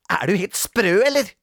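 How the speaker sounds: high, loud indignant snap; cross, bossy outburst; snapping. A narrator's reading. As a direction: snap at a silly suggestion. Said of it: "glefset Grete".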